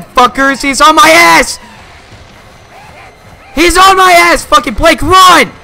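high, loud panicked screaming; raging gamer. Real, not acted. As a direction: scream for your partner to run. Chased by enemies, screaming.

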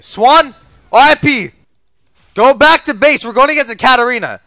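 loud, high team call. Real, not acted. Gamer warning Swan to head back, calling out. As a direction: call out over the fight.

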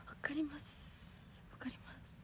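slow whisper of polite agreement; whispered polite redo. Shown as a demonstration. Idol quietly redoing her reply to a fan in keigo. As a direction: breathe the polite version out softly. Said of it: "まだ敬語にします".

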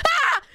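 short, high "ah!" of pain; pained yelp. Retold or imitated. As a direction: yelp as if burned. Acting out grease burn reaction.

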